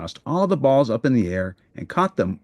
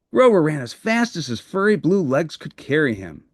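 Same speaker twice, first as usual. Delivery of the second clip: usual speaking voice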